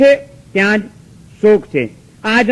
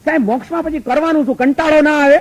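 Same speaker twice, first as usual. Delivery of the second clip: higher, quicker, wide-eyed questioning devotee; mock-naive follower asking if moksha gets boring; puzzled devotee; 6 semitones higher than usual. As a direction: play the naive listener asking the monk. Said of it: "ઘણા લોકો અમને પૂછવા આવે".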